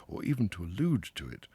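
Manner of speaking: plain reading voice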